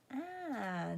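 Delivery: ordinary talk, nothing marked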